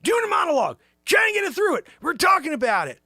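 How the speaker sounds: high, loud brash shout; Zabrosk voice. Acted. Sidekick shouting that Joanne cramps the Renaissance Man show. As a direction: bark it out like an offended showman.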